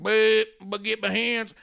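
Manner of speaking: slow, put-on dopey voice; mock dim Braun. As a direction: stammer it slowly like a big dummy. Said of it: "And Braun's like".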